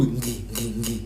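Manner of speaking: quiet, low repeats of "ngi"; "ngi" repeated as a sound demo; Zulu-style ngi. Shown as a demonstration. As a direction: sound out "ngi" with a nasal onset and hard g. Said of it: "like the Zulu people".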